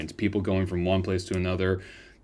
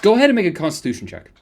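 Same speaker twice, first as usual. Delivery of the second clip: rapid excited GM call; loud and fast, high energy; 10 semitones higher, 7 dB louder, much faster than usual. Real, not acted. GM calls for check loudly.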